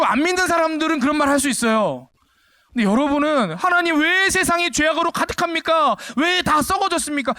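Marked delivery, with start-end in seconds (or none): 3.5-7.3 shouted high, whining lament to God; mock complaining prayer. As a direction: ham up a foolish believer's complaint. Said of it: "그런 미련한 말이 어딨냐고요". (어리석은 신자의 불평 기도, 높게 외치는 조롱조)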